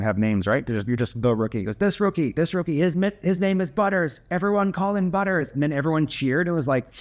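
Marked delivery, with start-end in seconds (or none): 1.8-5.5 high, proclaiming imitation of the bully; mock public announcement, held up high in pitch; announcing to team. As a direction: proclaim it to the whole room, without shouting. Bully announces rookie's name to team.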